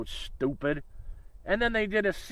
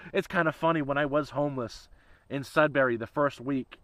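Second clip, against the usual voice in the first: ordinary delivery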